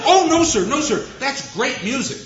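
high, loud mock student protest, all in a rush; excited protest. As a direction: protesteer als een opgewonden student.

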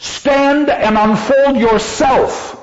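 a sentry challenging an unknown figure; guard's challenge. Acted. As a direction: order the stranger to stand. Acting the sentinel's challenge.

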